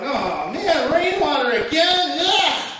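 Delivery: high, rising whine of a bratty plant; a little plant whining about rainwater; whiny little plant. Acted. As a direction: complain like a spoiled kid plant.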